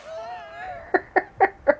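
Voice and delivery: long, squealing laugh; high-pitched laugh. Real, not acted. Laughing at the omitted actor.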